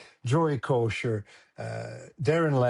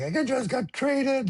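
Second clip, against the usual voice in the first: 1 retold crying; 12 semitones higher, slower than usual. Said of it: "And I'm crying".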